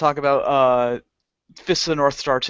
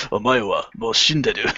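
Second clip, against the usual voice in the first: cool threat; 4 semitones lower than usual. Retold or imitated. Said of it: "They're just like".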